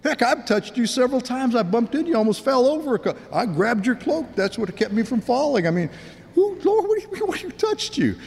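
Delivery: high-pitched flustered protest; baffled disciple. Acted. Disciple protesting to Jesus in the crowd, baffled and high. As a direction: pitch climbing into squeaky, stammering disbelief.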